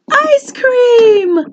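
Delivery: ice cream sung out high and bright with delight; thrilled to show the children; excited reveal. Acted. Teacher revealing ice cream to children, excited high voice.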